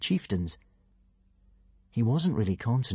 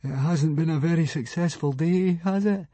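high, plaintive moan; 7 semitones higher than usual. A narrator's reading. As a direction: moan it in a higher, feeble voice. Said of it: "moaned Fishlegs".

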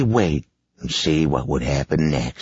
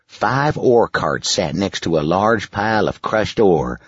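usual speaking voice

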